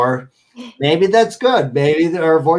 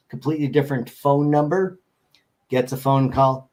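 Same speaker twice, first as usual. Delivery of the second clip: ordinary talk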